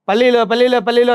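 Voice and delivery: sing-song street vendor cry; peanut vendor call. Retold or imitated. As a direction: call out peanuts like a beach seller. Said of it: "అని beach దగ్గర అమ్ముకుంటారు".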